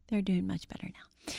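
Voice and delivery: hushed whispered aside; a quick whispered reassurance. Real, not acted. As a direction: drop to a private whisper. Aside about her daughters now.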